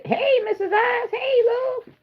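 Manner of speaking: high, lilting welcome; sing-song greeting. Real, not acted. Greeting a viewer warmly.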